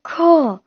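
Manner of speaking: ख drawn out slowly as a throat fricative, kh; nukta pronunciation. Shown as a demonstration. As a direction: demonstrate ख with a nukta, slow and isolated. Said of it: "इस तरीके से पढ़ते हैं".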